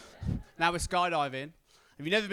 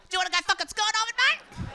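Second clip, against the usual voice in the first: much higher, fast, louder put-on voice; hyped skydiver; 6 dB louder, faster than usual. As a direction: rattle it off like a wild thrill-seeker. Huge skydiver greets him.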